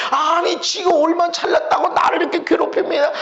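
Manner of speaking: high, plaintive grievance; indignant complaint. Acted. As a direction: act out a victim protesting to God. Wronged believer complains about others.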